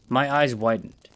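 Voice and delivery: ordinary delivery, nothing marked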